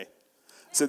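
ordinary delivery

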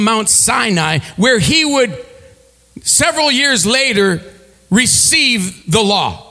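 rising preacher's voice, building the scene; preaching a Bible story with swelling pitch; sermon storytelling. Acted. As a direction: paint the desert for the congregation.

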